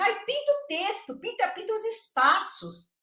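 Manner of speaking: high-pitched mock coaxing of a child; imitating teachers' advice. Teacher mimicking what colleagues tell a child, high mock coaxing. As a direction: pitch it up like talking to little kids. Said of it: "A gente fala assim pra criança".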